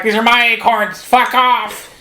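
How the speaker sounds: high, squeaky squirrel yelling; a tiny squirrel guarding its acorns; angry squirrel. Retold or imitated. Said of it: "the squirrel is like".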